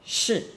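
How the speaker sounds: 是 high, falling sharply; short 是 dropped from high; fourth tone. Shown as a demonstration. Teacher giving one word for all forms, fourth tone. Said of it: "angry fourth tone".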